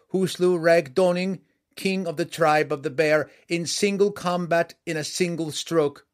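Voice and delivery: loud, high-pitched proclamation of titles; clear herald's cry. A narrator's reading. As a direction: ring out each title like a herald. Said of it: "cried out in a clear voice".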